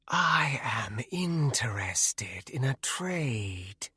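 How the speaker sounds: slow, breathy ghostly whisper; wraith whisper. A narrator's reading. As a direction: hush it out like a shadow wraith. Wraith bargains with the troll.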